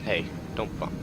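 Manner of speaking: ordinary talk, nothing marked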